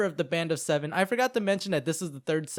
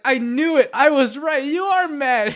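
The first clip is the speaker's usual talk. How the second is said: high, loud gloating shout; mock triumph; 11 semitones higher, 11 dB louder than usual. Inuyasha proving Kagome is mad, loud mock-triumphant accusation. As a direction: crow that you were right, hammed-up.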